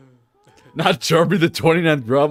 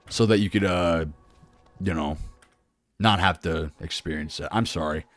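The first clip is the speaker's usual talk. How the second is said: low, soft, halting apology; subdued and hesitant, much lower and softer; sheepish apology; 9 semitones lower, 8 dB quieter than usual. Real, not acted. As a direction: apologise quietly, trailing into a mumble. Apologising to returning viewer.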